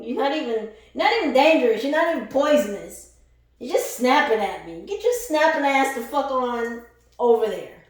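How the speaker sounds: loud, high, contemptuous telling-off; loud mocking scold at scorpions; scolding shout. Real, not acted. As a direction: run the pests off loudly.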